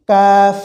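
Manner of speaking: কাফ as a light, long "kaaf"; কাফ drawn out with a light k; thin sound. Shown as a demonstration. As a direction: keep কাফ thin, with an open held "aa". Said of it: "চিকন আওয়াজে".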